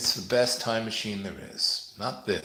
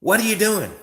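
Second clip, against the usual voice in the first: high, loud mock of the lady; startled woman's loud accusation; shrill accusing shout; 12 semitones higher, 7 dB louder than usual. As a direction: yell it at the two men. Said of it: "she goes".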